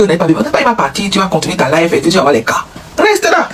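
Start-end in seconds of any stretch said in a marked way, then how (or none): 3.0-3.5 high squeaky plea. Pretend. Voicing partner.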